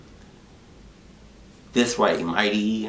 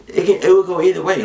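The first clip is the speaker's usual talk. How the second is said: "either way" fired out higher, louder and fast; emphatic burst; 11 semitones higher, 8 dB louder, faster than usual. Real, not acted. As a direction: hammer the point home, quick and loud.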